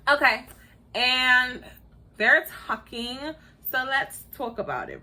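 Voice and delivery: ordinary delivery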